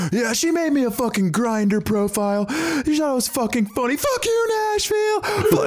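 mock a heckler straining to be heard; imitated fan yelling. Imitating the fan behind him, yelling a rant. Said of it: "yelling, but not saying just".

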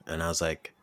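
ordinary talk

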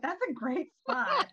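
tipsy, high and slow; delighted drunk discovery. Retold or imitated. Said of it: "And I was like".

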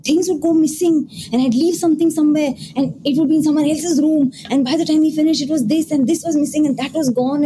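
lightly voiced tenant rattling off eerie losses; spooked horror story. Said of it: "he's telling me horror stories".

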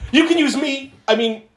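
high, eager imitated offer; flustered slip. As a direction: blurt it out, then correct yourself. Acted character's flustered slip of tongue.

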